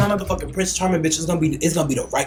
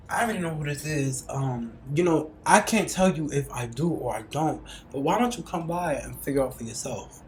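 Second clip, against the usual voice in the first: imitating him, lower and slow, suggestive; lightly voiced flirty guy; slow teasing man; 4 semitones lower, 9 dB quieter than usual. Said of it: "back to action".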